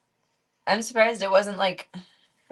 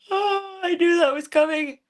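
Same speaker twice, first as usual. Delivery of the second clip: loud, high startled yelp; 9 semitones higher, 7 dB louder than usual. Real, not acted. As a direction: jump at it. Startled by something.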